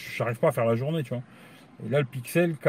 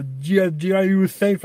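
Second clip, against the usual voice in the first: English accent; 4 semitones higher, 5 dB louder, much slower than usual. Shown as a demonstration. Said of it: "vous aurez compris mon accent, euh, fantastique".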